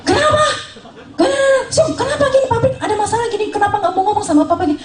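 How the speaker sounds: hammed-up panicked old man asking why; alarmed father-in-law. Father-in-law to son about the factory, mock-panicked and high.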